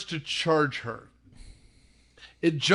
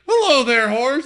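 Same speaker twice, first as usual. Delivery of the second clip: higher, louder chirpy hello to a horse; sweet greeting; 6 semitones higher, 7 dB louder than usual. Retold or imitated. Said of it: "she's like".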